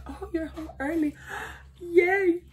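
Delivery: high, gushing fake delight with a gasp; sing-song fake surprise, then a delighted gasp; mock excited greeting. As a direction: gasp and squeal like an overjoyed wife. Mock-greeting husband home early.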